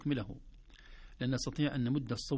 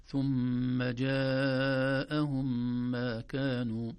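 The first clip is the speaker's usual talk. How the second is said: ثم جاءهم chanted slowly with four-beat madd; slow tajweed chant; Quranic recitation; 2 semitones higher, 6 dB louder, much slower than usual. Shown as a demonstration. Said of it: "المد الواجب المتصل".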